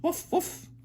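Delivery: high yappy woofs; dog bark. Acted. Parent showing what the dog says, dog bark. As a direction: yap like a little dog, very high. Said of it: "Dog says".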